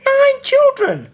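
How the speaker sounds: very high, loud, drawn-out disbelief; hammed-up shock, voice leaping right up; incredulous squeal. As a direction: squeal it slowly in mock astonishment. Exclaiming at woman's nine children.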